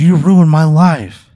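loud, high, drawn-out outcry; anguished accusation. Acted. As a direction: wail the accusation slowly at an enemy. Acted accusation before attack.

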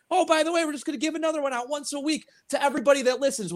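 high sing-song imitation of cohost; mocking imitation. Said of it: "Quit giving these goddamn things away".

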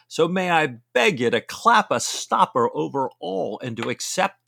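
rising, exasperated, amused plea to stop fretting; Stephen urging Jack. Acted.

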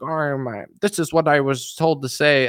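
put-on, weirdly artificial news-host delivery; imitating rehearsed political talk; mock politician voice. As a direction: parody a politician reciting a handed-down line. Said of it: "news hosts and politicians, they all talk like that".

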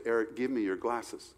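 soft, matter-of-fact wife voice; wife's quiet request. Retold or imitated. Wife quietly asks her husband for his smudged glasses, soft and plain. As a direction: ask for them softly, no fuss.

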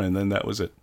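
ordinary delivery

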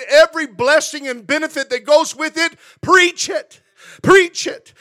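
rising to a loud, high preaching cry; a preacher rousing the congregation to preach it; preaching at full force. Real, not acted. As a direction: build it up and hit Preach it hard and high. Preaching building to climax.

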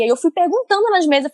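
ordinary talk, nothing marked

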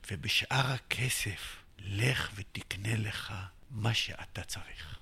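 soft, slow whisper; confidential whisper. Retold or imitated. The treasurer quietly advising him on the money: slow whisper. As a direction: lean in and whisper it kindly.

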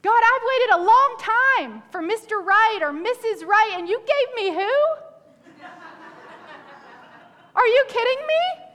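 mock exasperated complaint. Preacher jokes as a believer griping about a snoring spouse, exasperated. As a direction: ham up the disappointed single.

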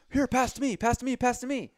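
high, loud repeated call for a pass; hammed-up teammate begging for the ball. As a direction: yell it up high like on court. Paul Rudd calling for ball.

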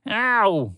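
high pained ow; moaning ow. A narrator's reading. Bird hurting after crashing headfirst, pained ow. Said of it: "the bird moaned".

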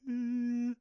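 high, steady held tone; imitated sine-wave tone. Shown as a demonstration. Demonstrating the duplicated mid-range bass sound, imitated tone. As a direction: hum a high synthetic tone on one pitch. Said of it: "it has this kinda, like".

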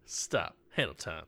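soft, flat deadpan call; flat and quiet. Shown as a demonstration. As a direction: mumble it without feeling. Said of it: "you gotta, you gotta give me that".

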